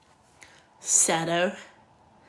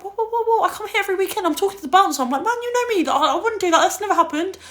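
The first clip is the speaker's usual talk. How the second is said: mock outraged protest; 10 semitones higher, 6 dB louder, faster than usual. Protesting to bar staff, retold.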